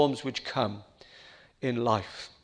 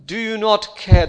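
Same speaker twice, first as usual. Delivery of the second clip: louder pleading disciple voice; mock frantic cry; 4 semitones higher, 8 dB louder than usual. Disciples waking Jesus in the sinking boat, loudly pleading. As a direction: plead desperately with the sleeping master. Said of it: "They cry".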